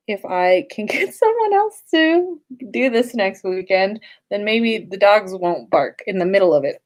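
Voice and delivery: ordinary delivery, nothing marked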